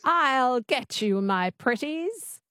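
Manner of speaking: slow, twangy witch voice; Wicked Witch. Shown as a demonstration. Student copying the coach's twang, Wicked Witch voice. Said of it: "Just say that".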